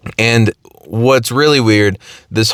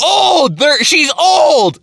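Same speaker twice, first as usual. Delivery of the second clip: much higher yelled blurt, slowing at the end; mom yelling; 14 semitones higher, much slower than usual. Retold or imitated. Mom yelling the answer at her kid on the phone, much higher. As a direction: blurt it out high and exasperated. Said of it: "she literally just yelled".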